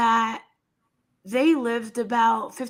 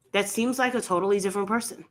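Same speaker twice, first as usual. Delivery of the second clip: usual speaking voice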